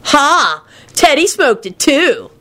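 a sulky dude yelling the same phrase; high exasperated slacker voice; high whiny stoner, irritated. Acted. Kenny voice.

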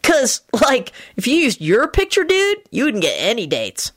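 high-pitched slacker-dude voice; Kenny. Acted. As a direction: explain it like an easygoing dude.

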